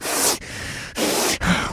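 imitated menacing heavy breaths; heavy breathing. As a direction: huff hard, nose to nose. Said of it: "there's, like, heavy breathing".

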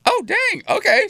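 high retold squeal of delighted surprise; imitating her own high-pitched delight. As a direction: squeal it like you can't believe your luck. Retold reaction to free gift card.

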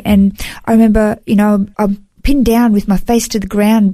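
ordinary talk

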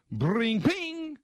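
a high twanging bring, ping; string snap noise. Retold or imitated. Host imitating his guitar string breaking: high twang. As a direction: mimic the guitar string snapping.

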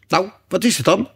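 high, impatient unicorn; exasperated high-pitched question. A narrator's reading. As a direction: demand it impatiently, pitch well up. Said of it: "riep de eenhoorn ongeduldig".

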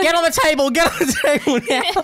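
high laughing mock yell. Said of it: "I'm yelling at them".

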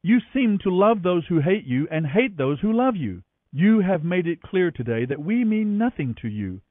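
stern rebuke. A narrator's reading. Joab rebukes the grieving king.

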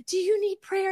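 lightly voiced little old lady offering prayer; imitating old lady. Little old lady asks him.

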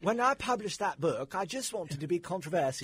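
high, loud put-on posh British voice. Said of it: "She's trying to come off as being British".

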